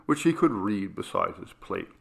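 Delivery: usual speaking voice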